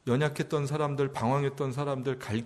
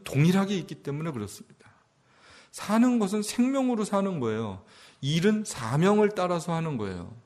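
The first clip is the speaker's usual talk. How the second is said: fervent preaching; 7 semitones higher than usual. Acted. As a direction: preach it out with full conviction. Pastor preaching at full force.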